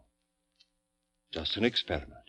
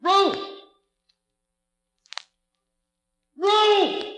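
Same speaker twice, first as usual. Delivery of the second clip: calling out loud; 11 dB louder than usual. Acted. Doctor calling through the house.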